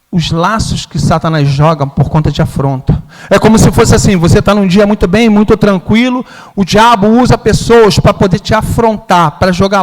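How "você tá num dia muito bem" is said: ordinary talk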